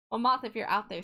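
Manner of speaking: ordinary delivery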